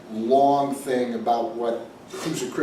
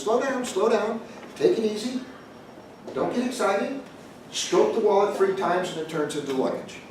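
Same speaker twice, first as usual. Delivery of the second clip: soothing taxidermist; 7 semitones higher than usual. Retold or imitated. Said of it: "and the taxidermist says".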